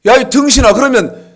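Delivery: angry yelling. Retold or imitated. Pastor retells shouting at an argumentative caller, loud and furious. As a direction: blow up at him as you tell it. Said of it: "얼마나 성질 나든지".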